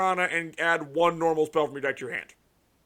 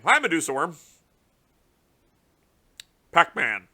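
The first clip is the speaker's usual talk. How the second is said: usual speaking voice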